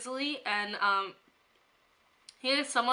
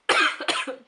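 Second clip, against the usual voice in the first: coughing; 4 semitones higher, 5 dB louder than usual. Real, not acted. Sore throat, coughing during video.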